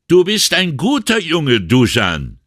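put-on called out high and loud to a departing boy; calling after him. A narrator's reading. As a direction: shout it after the kid as he climbs the bridge. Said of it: "rief Brunetti ihm nach".

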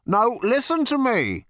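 high, slow, insistent order; frustrated, emphatic plea for attention; exasperated demand. A narrator's reading.

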